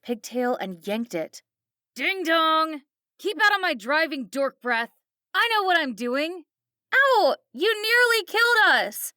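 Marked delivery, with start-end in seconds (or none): 1.9-6.4 higher, loud sneering taunt; bully the kid like a spiteful mom; coked-up mom taunting her daughter at the wheel; mocking drunk mother. A narrator's reading. 6.9-9.1 high, loud yelp then protest; a hurt kid shouting back; girl crying out in pain. A narrator's reading. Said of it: "Kelly's pigtail yanked".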